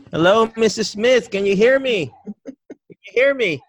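loud, high-pitched call to a patient; in-your-face loud greeting. Shown as a demonstration. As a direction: talk right into their face, much too loudly. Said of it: "we do this".

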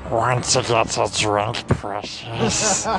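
slow, rasping creature voice; Smeagol. Acted. Creature flirting with the ladies for a joke, slow rasping hiss. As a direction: hiss the last word in a creepy creature voice. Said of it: "I do a hard Smeagol".

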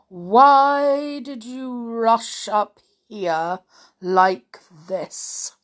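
slow, even, droning servant voice; monotonous butler. Acted. Butler Block relays the parents' message in a slow monotone. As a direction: drone it out with no life. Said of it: "curiously monotonous voice".

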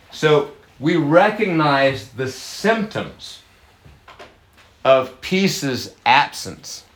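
drawn out, weighty pauses; slow emphatic teaching. Shown as a demonstration. Pastor slowly stressing wholeness to listeners. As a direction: lean on each word slowly.

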